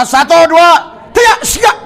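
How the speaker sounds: high, loud countdown; loud, high countdown call; countdown shout. Real, not acted. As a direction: shout the count to build the moment. Counting down to the healing.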